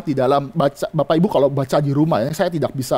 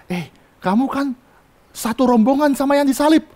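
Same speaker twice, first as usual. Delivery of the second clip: put-on gentle servant girl; 8 semitones higher than usual. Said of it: "berbicara lembut kepada Petrus".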